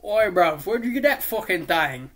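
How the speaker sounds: loud mock British geezer; put-on British accent. Osborn questioning the kids about the bomb, mock British accent. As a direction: ham up a British "bruv" accent. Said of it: "I'm just doing accents to change it".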